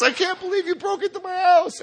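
put-on high indignant old lady voice; outraged old lady. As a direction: squeal it like an outraged neighbor. Neighbor outraged.

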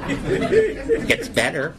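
slow drawn-out mocking repeat with a laugh; mock-hopeful. Shown as a demonstration. Comic mocking the AA slogan, slow sarcastic drawl.

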